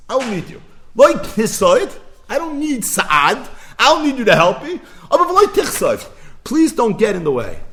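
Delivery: high, loud, proud declaration; defiant boast. Retold or imitated. Bar Kochva refusing heavenly help, defiant high shout. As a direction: shout it out like a cocky general.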